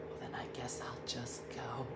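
whispered, mock hurt exit line; huffy whisper. Diana hanging up on Dean in a mock huff, whispered. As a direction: whisper it like sulking off. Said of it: "then she got real mad".

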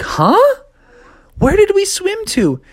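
high, confused frog; lost and wondering; high puzzled voice. Acted.

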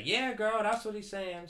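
high-pitched mock female cheering-on; put-on high girlfriend voice; imitated women listeners. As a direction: pitch it up like women agreeing with each other. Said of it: "all the women listening are gonna say".

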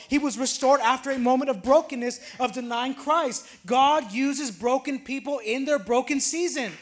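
a pastor driving his point home; full-force preaching. Acted. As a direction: preach it, pushing harder and harder.

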